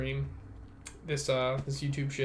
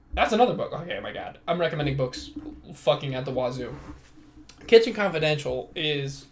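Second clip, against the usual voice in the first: ordinary delivery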